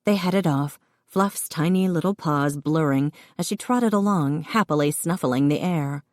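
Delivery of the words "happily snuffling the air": ordinary delivery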